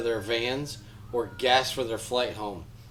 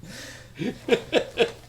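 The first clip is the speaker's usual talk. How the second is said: breathy giggle; 11 semitones higher than usual. Real, not acted. Laughing at hiccups.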